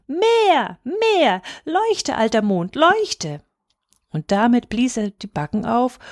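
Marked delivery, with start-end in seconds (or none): 0.1-3.4 high, insistent calls, dipping midway; demanding childish cry. A narrator's reading. Boy orders old moon to shine, demanding cry. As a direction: cry each command high like a small boy. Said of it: "schrie Häwelmann".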